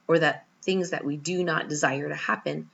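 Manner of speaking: normal talking voice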